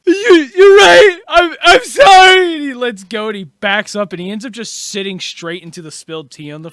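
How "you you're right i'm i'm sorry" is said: loud, high, drawn-out sobbing apology; hammed-up bawling; tearful wail. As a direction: wail it out like a distraught child. Character breaks down apologizing in game.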